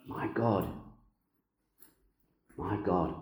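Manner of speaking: hushed murmured My God, my God; soft hushed repeat. Acted. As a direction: let My God, my God fall quiet and reflective. Repeats the cry softly after pause.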